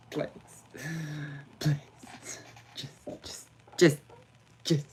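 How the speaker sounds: breathless whispered begging; whispered pleading. Real, not acted. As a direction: beg the connection softly. Pleading with internet.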